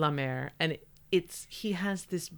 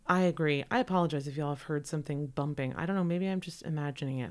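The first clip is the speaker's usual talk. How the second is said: ordinary talk, nothing marked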